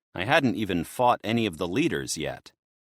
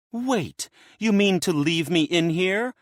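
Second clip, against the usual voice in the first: much higher, rising alarm, louder; worried; 9 semitones higher, 3 dB louder than usual. A narrator's reading. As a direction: plead with sudden worry. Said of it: "worry in her voice".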